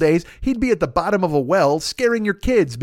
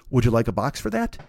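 polite waiter line; 7 semitones lower, 3 dB quieter than usual. Retold or imitated. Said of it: "his next line ... is".

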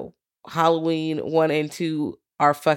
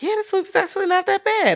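much higher, lightly voiced horror fans; mock fan voice. Horror fans suddenly praising the film, high mock voice. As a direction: chirp it like a convert. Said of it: "People were like".

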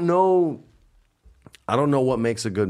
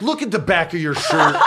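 exasperated retort; 7 semitones higher, 4 dB louder than usual. Retold or imitated. Retold wife's exasperated answer.

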